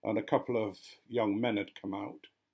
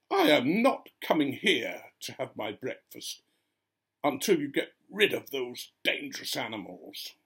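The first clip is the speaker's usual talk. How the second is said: mock-indignant woman, higher and louder; indignant refusal; 3 semitones higher, 4 dB louder than usual. Woman refusing breakfast until vicar removes foxes, indignant. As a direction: put your foot down, voice raised.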